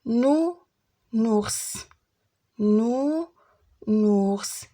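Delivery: "نو", pause, "نورس", each drawn out; split into syllables. Shown as a demonstration. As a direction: sound out "نو نورس" in two parts. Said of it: "لي سيلاب اللي فيهم او".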